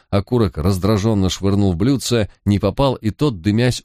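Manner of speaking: ordinary delivery, nothing marked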